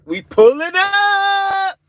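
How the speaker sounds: high, stretched playful shout; hyped-up holler. Real, not acted. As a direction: shout it long and high, hyping the visit. Playful announcement of a visit.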